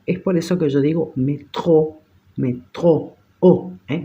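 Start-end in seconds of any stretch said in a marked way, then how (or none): 1.2-2.0 "métro" stressed on the final tro; stress on last syllable. Shown as a demonstration. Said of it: "se acentúan en la última sílaba". 2.3-3.2 "métro" with the tro stressed and rising; stress on last syllable. Shown as a demonstration. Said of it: "se acentúan en la última sílaba".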